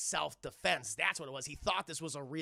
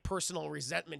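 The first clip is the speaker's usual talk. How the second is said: usual speaking voice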